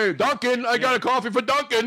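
high call across the coffee shop; mock barista. Acting a Starbucks barista calling for Duncan, high mock call. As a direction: sing out the order, pitched well up.

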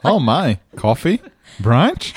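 bright rising question like a game show; excited ad pitch. Shown as a demonstration. Friend pitches cafe name for a commercial, loud and excited. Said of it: "how would you say their name?".

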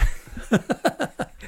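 high, breathy burst of laughter. Real, not acted. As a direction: laugh it off, amused. Laughs after the swearing joke.

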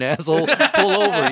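mock retort. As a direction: call it out, half laughing. Acted retort to driver, laughing.

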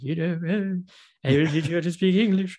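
put-on a sniffy old-timey lecturer; Rex Harrison impression. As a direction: put on a stiff period-film English voice. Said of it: "who's like".

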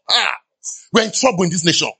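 usual speaking voice

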